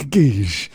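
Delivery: stuttered, hissy explosion noise; engine blowing up. Retold or imitated. Podcaster mocking an engine at ten thousand miles, blow-up noise. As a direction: imitate an engine self-destructing. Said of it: "a self-detonating engine at ten thousand".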